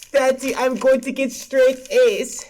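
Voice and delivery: put-on slow, eager little kid voice; proud child. Kid showing dad his class notes, slow eager child voice. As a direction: play a kid showing off to daddy.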